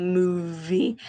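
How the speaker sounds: "Movie" broken open on a buzzed v; middle V shown. Shown as a demonstration. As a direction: draw out the v in "Movie". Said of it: "listen for that V sound".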